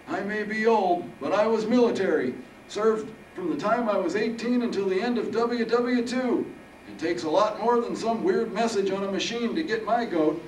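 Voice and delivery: put-on high, loud old-timer boasting; defiant old veteran Harold. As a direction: stand your ground as a feisty old soldier.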